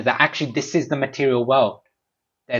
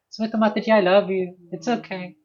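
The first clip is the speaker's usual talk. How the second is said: high, sugary I-love-you voice; doting sweet talk; 8 semitones higher than usual. Retold or imitated. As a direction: coo it sweetly, much higher than usual. Doting words to a crying friend.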